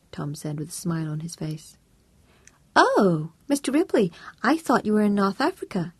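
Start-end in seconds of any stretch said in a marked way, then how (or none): 2.8-5.9 higher, louder surprised neighbour; bright American wife caught off guard; chirpy American woman. A narrator's reading. Janice surprised to hear from Tom, high and chirpy.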